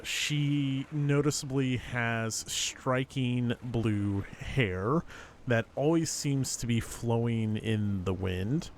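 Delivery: ordinary talk, nothing marked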